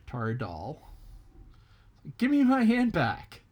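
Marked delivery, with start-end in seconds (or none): 2.2-3.4 high, loud, frantic rabbit voice; demanding rabbit. Acted. Stuck rabbit yells at tar doll, high and loud. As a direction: yell for your hand back.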